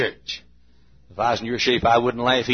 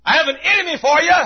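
high, fast preacher's shout; acted high-pitched shout; deep shouting across; 5 dB louder, much faster than usual. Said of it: "Deep shouts to shallow".

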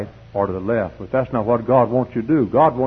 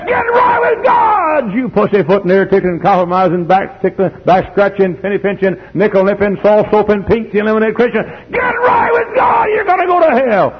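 high, loud hellfire shouting; hollering preacher; 9 semitones higher, 6 dB louder than usual. Acted. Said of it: "that hollering and screaming".

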